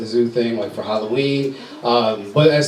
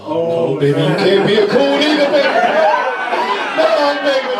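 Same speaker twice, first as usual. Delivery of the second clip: higher, louder panicked hushing; frantic dad; 11 semitones higher, 3 dB louder than usual. Retold or imitated. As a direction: stop the kid in a rush. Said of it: "I was like".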